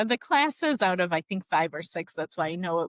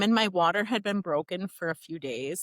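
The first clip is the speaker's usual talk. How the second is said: ordinary talk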